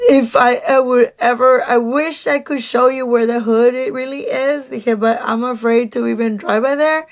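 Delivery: ordinary talk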